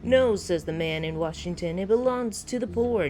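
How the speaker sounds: Andrew Ryan. Acted. Founder putting a question to the listener, declaiming. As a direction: declaim it like a rhetorical address. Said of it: "I am Andrew Ryan".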